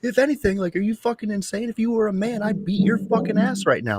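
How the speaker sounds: high-pitched incredulous rant; mock outrage. Dad venting at the absent mom, high incredulous rant. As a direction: rant at her like she's insane.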